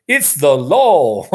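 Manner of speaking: slow, amused echo of a Southern drawl; laugh, then stretch it out in imitation; drawn-out mimicry through laughter; laughing mock drawl. Laughing echo of his accent video.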